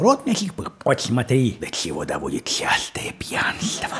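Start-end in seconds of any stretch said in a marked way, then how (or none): 1.6-3.9 low unvoiced whisper; hushed, much lower. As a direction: whisper it like a warning. Mock confiding about drinking.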